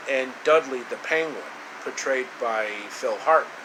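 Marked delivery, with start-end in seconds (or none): none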